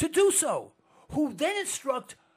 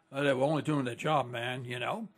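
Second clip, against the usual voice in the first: shrugging soldier; 10 semitones lower than usual. Retold or imitated. Mock guard excusing killings.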